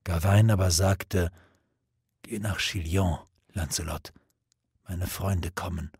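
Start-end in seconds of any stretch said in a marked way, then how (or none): 2.2-5.9 fading dying voice. A narrator's reading. Dying Gawain sends Lancelot away.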